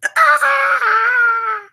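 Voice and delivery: high, loud squeal of glee; real delighted shriek; joyful scream. Real, not acted. Streamer sees the camera working, screams with joy.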